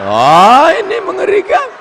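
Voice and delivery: sudden high shout of fake horror; comic shriek of dismay; mock horror. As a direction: gasp out in mock terror. Mock horror at lecturer bias.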